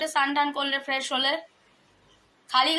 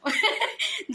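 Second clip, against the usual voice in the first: short higher-pitched giggle; laugh; 6 semitones higher, 4 dB quieter than usual. Real, not acted. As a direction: let out an amused little laugh. Amused while urging father to scold.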